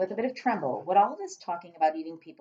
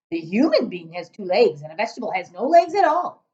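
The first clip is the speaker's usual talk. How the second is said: giant voice; 2 semitones higher, 9 dB louder than usual. Acted.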